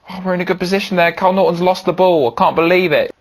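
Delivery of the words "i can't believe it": normal talking voice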